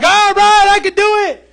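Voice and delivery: psyched to get cussed out for the money; eager. Retold or imitated. Preacher playing himself, ready to be cussed out: eager, high and loud. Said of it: "I'm gonna be like".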